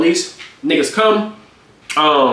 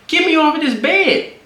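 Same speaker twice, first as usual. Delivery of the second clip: high, slow mock screech; mock girlfriend demand; 13 semitones higher than usual. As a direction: mimic her demand in a high voice. Imitating girlfriend yelling.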